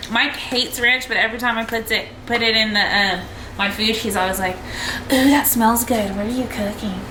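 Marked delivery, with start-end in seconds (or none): 5.1-7.0 imitating husband as a fake princess; lightly voiced prissy princess. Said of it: "You make me sound like a fake princess".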